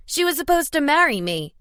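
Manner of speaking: high, loud wounded yell. A narrator's reading. Tom yelling at Marcos, high and loud. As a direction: shout it like a rejected suitor. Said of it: "Tom yelled".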